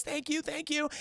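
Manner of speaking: soft, high mock-tearful gratitude; tearful thanks. Retelling Felicia thanking Peter for the ride, tearful. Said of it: "She goes".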